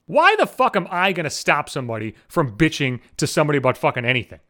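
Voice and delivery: usual speaking voice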